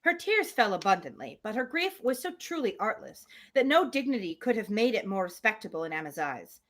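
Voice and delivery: usual speaking voice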